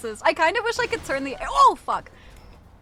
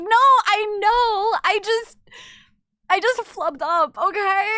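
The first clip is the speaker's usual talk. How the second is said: flustered defense; 8 semitones higher, 8 dB louder, slower than usual. Retold or imitated. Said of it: "And it's like".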